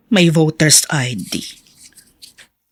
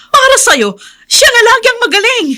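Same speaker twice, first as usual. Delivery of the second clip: much higher, louder angry complaint; heated protest; 6 dB louder than usual. Acted. Mother confronting her husband about favouring Omar, high and loud. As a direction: let the frustration burst out.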